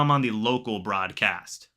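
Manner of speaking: ordinary delivery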